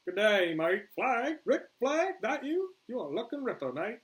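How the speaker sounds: high, loud broad Australian greeting; Australian accent. Acted. Boomer hails his old friend Flag, Australian accent. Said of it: "I can't do an Australian accent".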